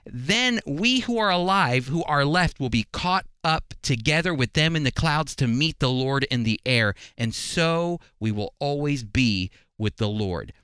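normal talking voice

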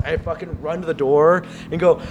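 normal talking voice

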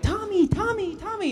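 put-on high, excited children's chant; kids calling out. Kids in Mexico mistake him for Tommy, calling excitedly. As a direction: call out like excited fans.

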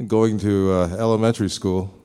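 normal talking voice

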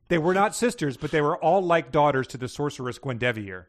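animated read-aloud of a story; story narration. A narrator's reading. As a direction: read the tale out clearly and briskly. Reads submitted story aloud.

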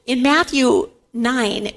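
usual speaking voice

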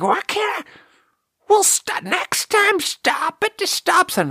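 very high, strained craggly geezer; hammed-up screechy scolding, spluttering restart; aggro old man. Mad old man at the dump scolding driver, high aggro screech. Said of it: "He's aggro".

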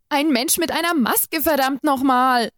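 panicked cry. A narrator's reading. Captive cries out in panic at exorcist, high and desperate. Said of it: "rief ich mittlerweile panisch".

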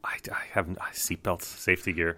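sheepish whisper. Real, not acted. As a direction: whisper it, a bit embarrassed. Embarrassed admission.

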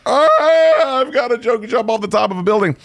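high, strained mock wail of despair. Picturing being yelled at in a Jersey accent, a high mock wail. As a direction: whine it like you want to leap off a roof. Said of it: "I'm like".

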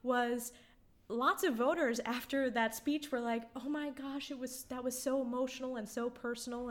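holding back tears while talking; choked up. Real, not acted. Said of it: "getting choked up".

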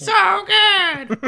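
very high squeal; squeaky high. Acted. Echo of so good.

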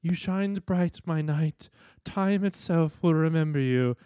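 loud, high, grandly solemn farewell; theatrical high-pitched proclamation; Octavia. Acted. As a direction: declaim a noble tribute, hammed up. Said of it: "and then Octavia says".

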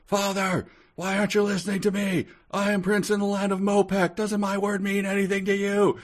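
high, fast, squeaky mock yell; a prince shrieking at his father, played for laughs; hammed-up yell. Prince yells at his father, high squeaky mock yell. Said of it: "turned around and yelled".

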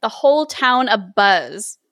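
ordinary delivery

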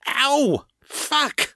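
high-pitched pained yelp; shouted. Acted. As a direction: yelp and curse as you land. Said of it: "Rashid shouted".

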